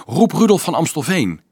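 urgent advice. A narrator's reading. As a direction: urge them to fetch help. Monk urgently advises fetching Rudolf.

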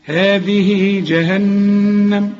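"هذه جهنم" very slow, the haa vowel held two beats and the nun hummed; measured tajweed reading of "هذه جهنم"; drawn-out recitation. Shown as a demonstration. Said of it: "حركتين عند الوصل".

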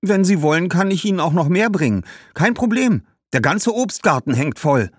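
put-on high, breezy girl's voice; eager young girl.

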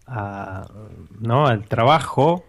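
ordinary talk

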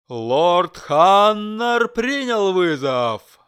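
very slow, loud proclamation; roaring herald. A narrator's reading. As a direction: roar it to the stands. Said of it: "проорал Геральт".

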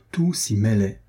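usual speaking voice

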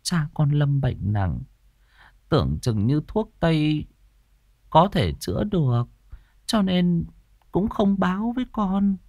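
high, tearful mother's lament; grieving widow, pitch climbing as she breaks down; sobbing grief. A narrator's reading. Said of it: "Bà Hỏa sụt sùi".

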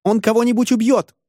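very high-pitched squealed warning; women's shriek. A narrator's reading. Women warn he will kill somebody, shrieking high. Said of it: "завизжали женщины".

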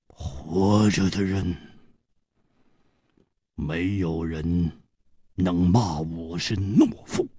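low, slow, measured menace; slow, deliberate. A narrator's reading. Swordsman answering over the man who called him coward, slow and deliberate. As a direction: draw it out slowly with long pauses. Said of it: "缓缓地说".

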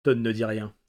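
plain reading voice

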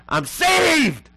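full-out high, loud shout; yelling out. Acted. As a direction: yell it like a joyful fool in a store. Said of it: "yelling out".

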